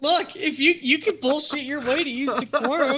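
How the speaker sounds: high, loud, laughing through words; cracking up mid-sentence. Real, not acted. GM laughing at roll.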